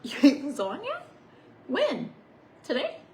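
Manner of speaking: loud high disbelief; surprised. Real, not acted. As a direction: act shocked at the answer. Mock disbelief at child.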